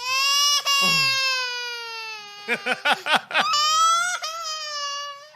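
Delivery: howling, high-pitched laughing fit; loud burst of laughter. Real, not acted. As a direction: break into a loud shrieking laugh. Cracking up after accent slip.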